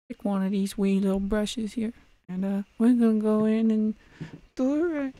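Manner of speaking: high, put-on Oni voice; talking like Oni. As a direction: squeak it out like Oni. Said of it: "trying to talk like Oni".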